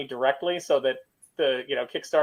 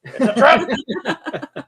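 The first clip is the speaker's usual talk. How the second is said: dramatic movie quote; 8 semitones higher, 3 dB louder, much slower than usual. Retold or imitated. Quoting Star Wars line.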